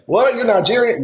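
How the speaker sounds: high surprised "what, you're Nigerian?"; imitating a surprised listener; incredulous question. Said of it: "they don't see, like".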